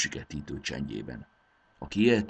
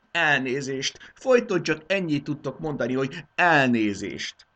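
mocking repeat; 8 semitones higher, 6 dB louder than usual. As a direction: throw the apology back at him. King mocks the servant's apology.

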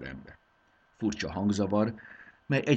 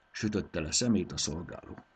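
ordinary delivery, nothing marked

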